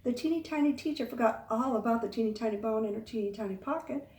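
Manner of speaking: ordinary delivery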